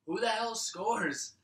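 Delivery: ordinary talk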